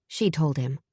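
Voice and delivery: usual speaking voice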